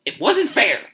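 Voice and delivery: high-pitched complaint, falling; protesting. A narrator's reading. Wife insisting the draw was unfair, protesting. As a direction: whine the objection up high.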